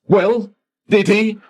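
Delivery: urgent high question. Acted. Demands answer from clerk.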